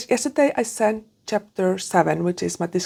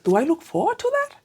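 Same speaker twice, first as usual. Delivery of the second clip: high-pitched self-doubting question; mock-doubtful question to oneself; questioning herself; 8 semitones higher than usual. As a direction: ask it as if doubting yourself, pitch rising high. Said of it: "I was like".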